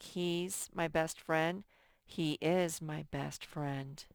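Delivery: "He's" then "He is" spelled out slowly; slow, stress "is" in the full form; slow example sentences. Shown as a demonstration. Teacher to class, contrasting contraction and full form slowly. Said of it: "It's a contraction".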